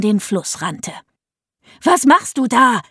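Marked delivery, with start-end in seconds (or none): 1.8-2.8 mom shouting. A narrator's reading. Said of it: "schrie Mom".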